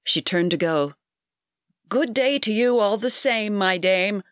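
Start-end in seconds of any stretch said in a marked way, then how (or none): 1.9-4.2 raised, higher-pitched call, a mild shout; shouting for old ears. A narrator's reading. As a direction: call out clearly for someone hard of hearing. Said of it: "shouting for the benefit of the ancient ears".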